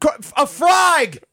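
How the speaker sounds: high, drawn-out Aussie-Cockney shout; muddled Australian-Cockney accent. Shown as a demonstration. Voice actor repeats the callout for his co-host, shouted Australian-Cockney accent. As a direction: stretch it out in bad Australian-Cockney. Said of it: "I want you to use that accent".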